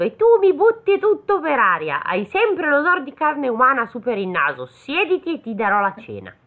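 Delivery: put-on high, loud nagging old granny; scolding grandma. Grandmother scolding the devil, high and loud. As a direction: scold him like a fussy grandmother.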